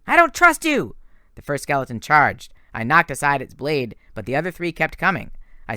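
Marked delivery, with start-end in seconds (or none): 0.0-1.0 high, loud refusal; defiant scream. A narrator's reading. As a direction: shout him down in distrust. Nico screaming at Percy.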